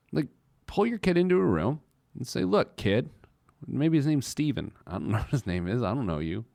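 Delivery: usual speaking voice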